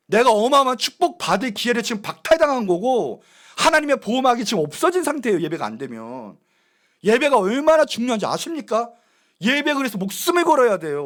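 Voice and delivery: loud, urgent pulpit preaching; preaching at full force. Acted. Pastor warning the congregation, preaching loud and urgent. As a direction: press the point home to the pews.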